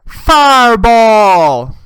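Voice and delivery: very long, high mock holler; imitated yell. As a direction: belt out the party cry, stretched. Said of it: "she just goes".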